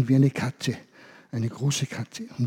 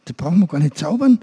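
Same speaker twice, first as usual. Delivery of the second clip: wise monkey's advice; 4 dB louder than usual. Acted. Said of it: "Und er sagte".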